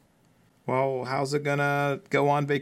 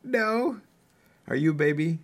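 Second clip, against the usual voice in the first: put-on baby talk voice; 7 semitones higher than usual. Teasing toddler.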